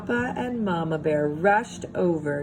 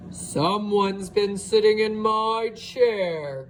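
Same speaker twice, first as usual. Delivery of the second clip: slow, big grumbling bear voice; grumbling Papa Bear; 2 semitones higher, slower than usual. A narrator's reading. Papa Bear finding his chair used, slow grumble. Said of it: "grumbled Papa Bear".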